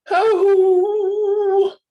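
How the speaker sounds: high, loud wailing oh; howl. Acted. The wolves howl as the nest splashes, loud high wail. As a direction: let out a big dismayed howl. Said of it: "howled little Wolf and Smells".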